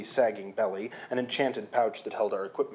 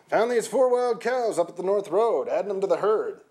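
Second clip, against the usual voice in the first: higher, louder rough gamekeeper voice; gruff gamekeeper; 9 semitones higher, 5 dB louder than usual. Acted. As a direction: play the swaggering gamekeeper. Gamekeeper voiced, other figure.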